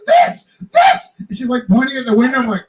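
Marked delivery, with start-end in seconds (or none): 0.1-1.1 much higher, louder crying shout, hammed up; frantic store lady. Store lady pointing at her window, much higher crying mock shout. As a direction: jab each word like pointing.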